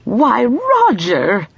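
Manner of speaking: slow, high delighted exclaim; pleased phone greeting, drawn out and high; exclaimed with pleasure. A narrator's reading. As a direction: greet him with open joy. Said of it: "she exclaimed with pleasure".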